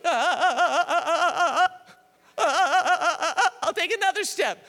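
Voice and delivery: a hint of trembling Harriet inching forward; mock trembling fear. Preacher acting Harriet trembling before each step, mock fearful. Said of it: "she would stop and wait".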